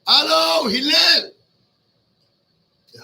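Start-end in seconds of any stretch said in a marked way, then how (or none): 0.1-1.3 loud, insistent shout of his name. Retold or imitated. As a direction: yell it to drag him out of the shower. Man yelling for Hillel again.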